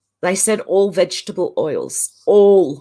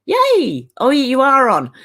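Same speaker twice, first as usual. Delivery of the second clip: high, loud happy yay; delighted yay; excited cheer; 6 semitones higher, 5 dB louder, slower than usual. Real, not acted. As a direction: cheer like good news just arrived. Viewer will catch her live.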